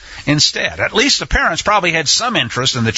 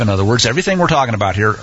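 ordinary delivery

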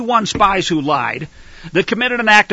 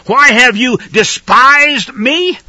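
loud, higher, accusing demand; angry God; 4 semitones higher, 4 dB louder, slower than usual. Acted. God rebuking his people through the preacher, angry loud demand. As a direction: hurl the question like an angry God. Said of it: "you're right, 'cause he was".

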